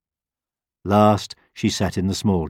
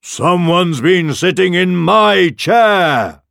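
slow, loud roar with raised pitch; father bear roar; 10 semitones higher, 7 dB louder, slower than usual. A narrator's reading. Father Bear finds his chair used, roaring. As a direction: roar it out slowly as Papa Bear. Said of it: "roared the father bear".

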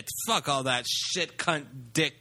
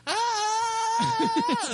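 much higher mock rock scream. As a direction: belt a short hard-rock cry. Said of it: "my impression of [name] doing an impression of [name]".

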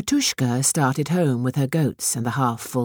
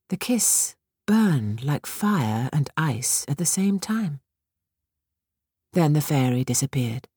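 usual speaking voice

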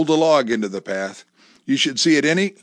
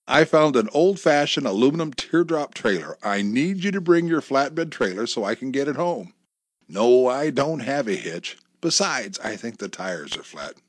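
ordinary delivery, nothing marked

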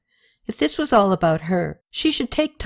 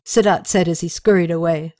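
ordinary delivery

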